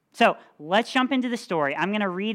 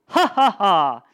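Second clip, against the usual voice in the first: loud, hammed-up villain laugh; mock evil laugh; booming giant laugh; 2 semitones higher, 9 dB louder than usual. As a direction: boom it out like a giant. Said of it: "he laughed his terrible laugh".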